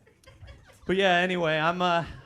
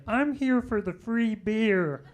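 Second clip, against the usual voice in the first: slow mock brother; 6 semitones higher, 4 dB louder, slower than usual. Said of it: "My brother's just like".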